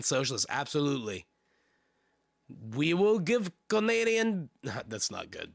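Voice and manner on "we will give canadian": higher-pitched, hammed-up fake French accent; bad French accent. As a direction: attempt a French accent and do it badly. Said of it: "That's not a good accent".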